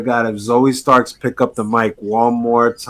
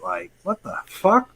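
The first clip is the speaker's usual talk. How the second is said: ordinary talk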